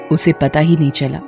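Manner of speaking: ordinary delivery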